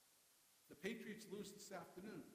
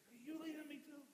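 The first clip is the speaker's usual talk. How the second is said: higher, frustrated pleading question; frustrated, in anger; 9 semitones higher than usual. Shown as a demonstration. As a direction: throw the question out in exasperation. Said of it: "He could have said it in anger".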